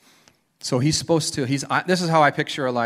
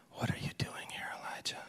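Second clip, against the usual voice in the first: soft unvoiced whisper; gentle whisper; 15 dB quieter than usual. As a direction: breathe it gently into his ear. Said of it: "this gentle whisper".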